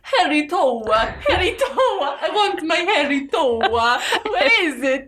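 high, hammed-up Italian singsong; Italian accent on the edge of laughter; terrible Italian accent. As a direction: do a badly faked Italian. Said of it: "Oh, terrible Italian accent".

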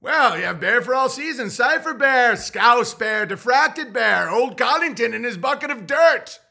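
high, loud grand roll call; mock herald roll call. Listing the Bears to the clerk, a high herald roll call. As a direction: announce each name like a herald. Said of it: "Who are these Bears".

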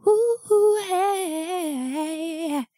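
normal talking voice

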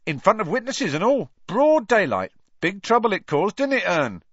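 high, loud cockney lad, mocking; Stan's voice. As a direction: tease him like a cheeky bus conductor. Stan telling the tale.

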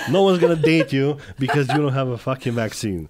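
loud laugh spilling into the words; laughing loudly. Real, not acted. Host cracking up at his guest's joke, loud laughing.

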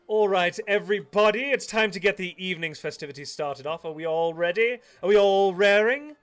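bubbly stage host calling to the room; showy hostess. Acted. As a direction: announce the act like a glamorous hostess working the crowd. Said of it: "as Diamond Eyes steps out".

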